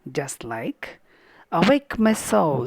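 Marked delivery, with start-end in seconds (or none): 1.5-2.7 "Awake, my soul" louder and higher, weak-strong beat; iambic stress. Shown as a demonstration. Teacher showing students an iambic line, "Awake, my soul" in weak-strong stress. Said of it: "unstressed syllable is followed by stressed syllable".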